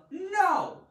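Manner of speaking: no said high and loud; stressed no. Shown as a demonstration. Teacher repeating the emphatic no. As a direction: hit the word no hard. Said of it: "emphasizing the point of no".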